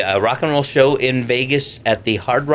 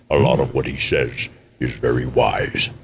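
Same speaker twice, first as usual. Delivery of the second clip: put-on wise old character; 8 semitones lower than usual. Shown as a demonstration. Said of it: "he's more of a wise character".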